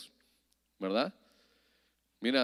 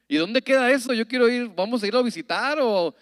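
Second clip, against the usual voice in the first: put-on higher, louder innocent boy; eager child; 8 semitones higher, 9 dB louder than usual. Boy thinks divorce is a place to visit, eager and higher. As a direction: sound like a keen little kid.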